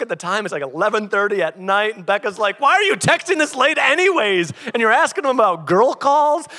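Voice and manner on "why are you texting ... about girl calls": high, loud incredulous demand; comic accusing outcry; mock outraged questioning. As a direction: imitate a scandalised friend, voice raised. Acts out Becca's late-night challenge.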